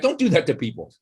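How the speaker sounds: ordinary delivery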